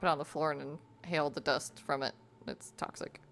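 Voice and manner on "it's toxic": whisper. Real, not acted. Warning about malachite dust.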